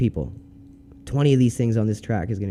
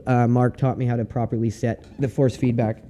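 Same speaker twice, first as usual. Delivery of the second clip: ordinary talk, nothing marked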